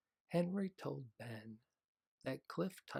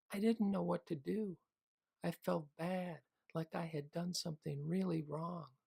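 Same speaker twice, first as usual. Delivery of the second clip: put-on child voice; 7 semitones higher, 6 dB louder than usual. Child voice in story.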